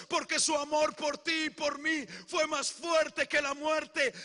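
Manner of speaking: building to a loud, fervent climax; preaching at full force. Acted. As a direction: preach it, rising to a shout on resucitó. Predica con fuerza creciente. (predicación con fuerza creciente, casi a gritos)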